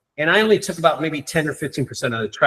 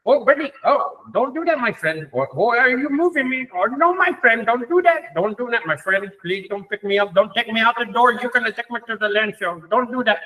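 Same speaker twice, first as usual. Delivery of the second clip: higher-pitched, put-on Indian accent; 5 semitones higher than usual. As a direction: plead in a mock Indian voice. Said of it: "I'll do my Indian voice".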